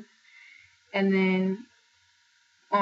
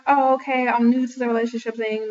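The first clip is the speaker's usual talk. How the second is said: naive eager self; 4 semitones higher, 5 dB louder than usual. Retold or imitated. Voicing her naive younger self.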